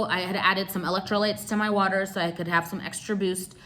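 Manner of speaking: ordinary talk, nothing marked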